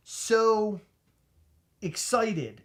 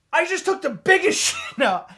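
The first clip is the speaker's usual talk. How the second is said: high, louder laughing; excited, laughing; 10 semitones higher, 8 dB louder than usual. Retold or imitated. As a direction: blurt it through laughter. Excited retold line.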